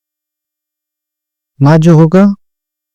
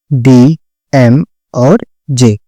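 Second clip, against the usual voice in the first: slow spelling; 3 semitones lower, much slower than usual. Shown as a demonstration. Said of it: "damage".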